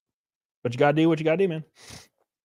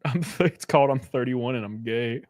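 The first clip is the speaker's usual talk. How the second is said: usual speaking voice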